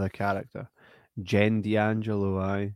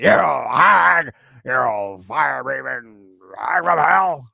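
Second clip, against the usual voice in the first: louder, slow put-on zombie voice; zombie curse; 6 dB louder, slower than usual. Retelling the first film, the zombie cursing the witch, slow and loud. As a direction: drag out the curse like a risen corpse. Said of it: "He's like".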